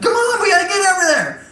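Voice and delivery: high, fast goblin call; hurried goblin. Acted. Goblins running into the village, high and fast. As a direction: squeak it out while running. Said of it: "They're like".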